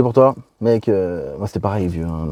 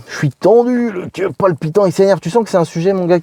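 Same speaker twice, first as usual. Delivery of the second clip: tense and worked up; 8 semitones higher than usual. Real, not acted. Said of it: "Putain, je suis tendu".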